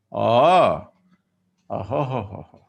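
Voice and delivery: loud oh, then fading knowing ho-ho; a slow threatening realisation; ominous oh-ho. Acted. Son reacting to insult.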